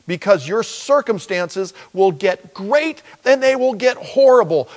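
high, loud, fast preaching as Jesus; Jesus speaking through the preacher, warning about good days. Acted. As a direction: rush the warning with fervour.

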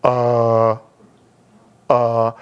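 imitating someone stuck for an answer; long, low, blank "uh"; mock being lost for words; stumped stammer. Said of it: "They go like this".

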